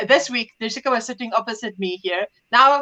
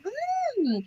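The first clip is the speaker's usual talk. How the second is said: high, soft token hum; feigned interest; 12 semitones higher, 11 dB quieter than usual. Retold or imitated. Mother humouring her daughter, disinterested. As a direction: hum along politely without caring. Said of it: "So, so disinterested".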